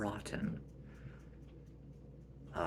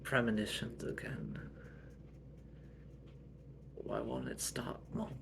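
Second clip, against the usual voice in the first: hushed worry; 2 semitones lower, 6 dB quieter than usual. Acted. Scared by visions.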